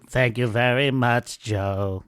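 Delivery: staying in the put-on frontman voice; thanks given in a mock singer's voice. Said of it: "Now do some ABBA with that voice".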